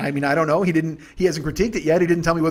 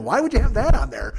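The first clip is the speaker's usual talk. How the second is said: higher, lightly voiced puzzled child; questioning kid; 5 semitones higher than usual. Six-year-old challenging him about the sock, mock puzzled kid. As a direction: imitate a kid demanding an explanation.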